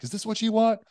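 high, loud imitated scream; retold scream. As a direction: act out screaming a desperate challenge. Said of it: "screaming, like".

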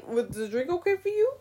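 a lightly voiced, overly sweet server; mock waiter. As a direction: parody a waiter's fake politeness. Mocking waiter asking about drink.

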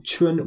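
chun held high and level; first tone. Shown as a demonstration. Teacher models the word for spring, chun high and level. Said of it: "C H U N in the first tone".

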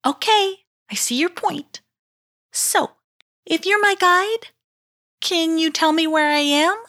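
put-on high, meek girl voice; demure. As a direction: ask meekly, a little chastened. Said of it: "asked a more demure Heichu".

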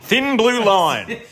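very high, very slow, drawn-out; milk it like a grand tribute; mock solemn. Host ending a cop story, mock solemn salute.

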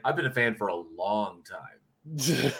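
every word stretched out slowly; slow, drawn-out fan line. Shown as a demonstration. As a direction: fan gushing to Tracy Lords, slowly drawn out. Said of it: "I could have said".

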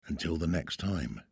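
ordinary delivery

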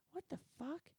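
high-pitched, mildly exasperated retort; annoyed. Retold or imitated. Performer reacting to being kept waiting, annoyed. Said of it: "So I'm a little annoyed".

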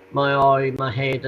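ordinary talk

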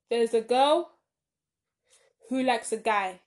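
loud, slow, emphatic plot summary; deliberate explaining. Real, not acted. Explaining a tangled story to listeners, slow and deliberate. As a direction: lay out each piece loudly, with long gaps.

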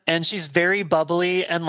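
usual speaking voice

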